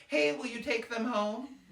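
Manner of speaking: imitated mom's sing-out request; wheedling mom. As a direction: ask a slow, high favor as mom. Mom asks tired dad favor.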